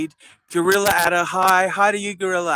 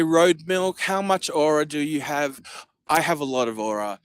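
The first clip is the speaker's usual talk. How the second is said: ordinary delivery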